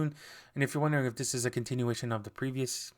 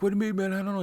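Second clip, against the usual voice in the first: alarmed; 8 semitones higher, 3 dB louder, much faster than usual. Retold or imitated. Panicked witness in retold scene.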